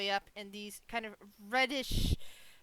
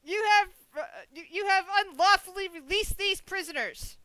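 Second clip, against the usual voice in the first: high, loud proclamation of the offence; shouted command; 8 semitones higher, 6 dB louder than usual. Acted. Rider demands the group surrender for releasing prisoners, shouting. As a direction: shout it as a stern order. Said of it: "it, it, and shouts".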